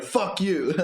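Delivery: high-pitched mock outburst; mock aggressive. Reader imagines the partner's aggressive words, mock aggressive. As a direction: throw it out as a hammed-up insult, laughing after. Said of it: "Oh, that's aggressive".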